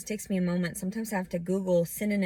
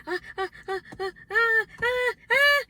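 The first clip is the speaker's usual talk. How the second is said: high, repeated "ah" building louder; acted-out freak-out cries; 5 dB louder than usual. Freaking out because nobody understands, mock panicked yelps. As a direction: escalate short high yelps into loud cries.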